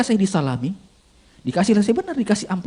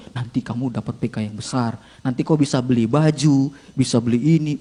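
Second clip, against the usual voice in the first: mysterious inner voice; 6 semitones lower than usual. Acted. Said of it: "suara itu ada terus".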